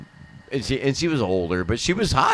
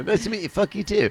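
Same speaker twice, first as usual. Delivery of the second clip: put-on English accent; 6 semitones higher, 5 dB quieter, faster than usual. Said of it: "just be an English".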